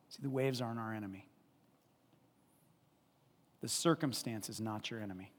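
normal talking voice

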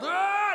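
high loud groan; mock anguished cry. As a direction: let out a desperate wail. Mocking desperate hunger.

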